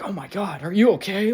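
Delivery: high, rising alarmed question; alarmed concern. Retold or imitated. Undercover cop rushing over to the accident, alarmed. Cop reacts to woman hit by car.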